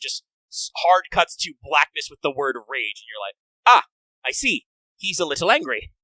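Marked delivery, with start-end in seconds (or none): 3.7-5.9 raised, emphatic dawning understanding; comic understatement after a sharp "ah"; mock realization. Viewer reacting to the rage screen, mock enlightened.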